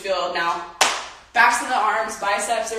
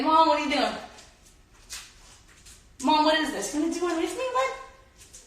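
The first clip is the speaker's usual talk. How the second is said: voicing the dog; 7 semitones higher than usual. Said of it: "He's like".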